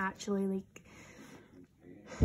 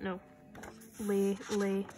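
ordinary talk, nothing marked

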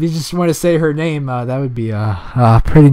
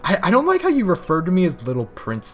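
ordinary delivery

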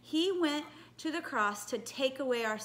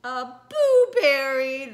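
loud, slow, drawn-out punchline repeat; big exaggerated appreciation; delighted reaction; 12 dB louder, slower than usual. Real, not acted. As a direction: boom it out with delight, stretching the word.

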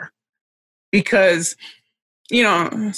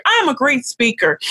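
"I'm" lifted much higher, bright repeat; echoing affirmation; 7 semitones higher than usual. Retold or imitated. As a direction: chime it back higher like a girl at the mirror. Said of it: "And she would say".

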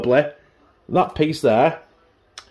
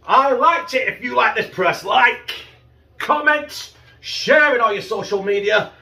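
excited; 7 semitones higher, slower than usual. Real, not acted. Upbeat outro call to subscribe.

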